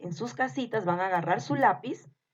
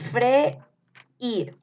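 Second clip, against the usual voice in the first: "Freír" split as fre, pause, ír, higher; syllable-split dictation; 4 semitones higher, 4 dB louder than usual. Shown as a demonstration. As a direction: break "Freír" clearly between the two vowels. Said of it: "les voy a ir dictando". (dictado separando "Freír" en sílabas)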